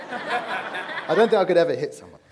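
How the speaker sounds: laughing through the words; self-mocking laughing admission. Real, not acted. Laughing at himself.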